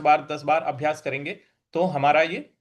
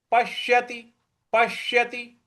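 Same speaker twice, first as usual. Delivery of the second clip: पश्यति with a leaned-on, doubled श; higher level tone, press the श in पश्यति; stress on half श; 6 semitones higher, slower than usual. Shown as a demonstration. Teacher modelling पश्यति, stressed श. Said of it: "उस पर हमें आघात देना है".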